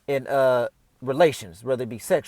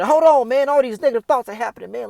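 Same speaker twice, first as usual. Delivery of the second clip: louder and higher, snapping out of it; loud, higher halt to negative thoughts; sudden self-interruption; 6 semitones higher, 7 dB louder than usual. Pretend. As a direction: catch yourself mid-spiral, louder. Catching his spiralling thoughts.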